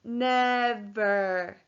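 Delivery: NEVER drawn out in two long syllables; said slowly. Shown as a demonstration. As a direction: stretch each syllable of NEVER. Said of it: "Say the word slowly".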